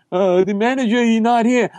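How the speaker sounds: high, put-on employee voice; hesitant excuse from behind the counter; imitated high-voiced deli worker; counter guy.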